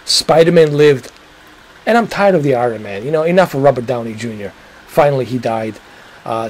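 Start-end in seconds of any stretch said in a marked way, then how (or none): none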